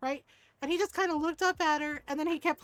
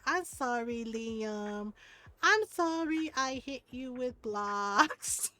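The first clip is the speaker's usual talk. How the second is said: put-on slow, drawn-out kid's sorry; sheepish child apology; 3 semitones lower, much slower than usual. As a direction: imitate a caught child apologizing. Retold child's reluctant apology.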